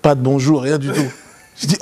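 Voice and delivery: ordinary talk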